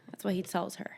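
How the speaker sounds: hushed, unvoiced comment; whispered aside. Real, not acted. Aside to a friend, hushed whisper. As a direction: whisper it like gossip.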